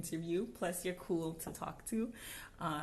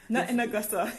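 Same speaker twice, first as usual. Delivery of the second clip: laughing; 4 semitones higher, 6 dB louder, faster than usual. Real, not acted. Both laughing at tease.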